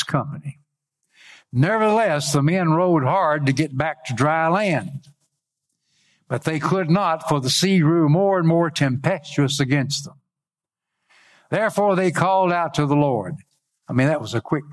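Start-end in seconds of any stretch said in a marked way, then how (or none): 1.5-13.4 higher-pitched, declaimed Bible verse; scripture reading. Acted. Preacher reads of men rowing against the sea, proclaiming. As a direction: proclaim the verse, rising high then falling at phrase ends.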